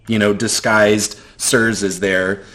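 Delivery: usual speaking voice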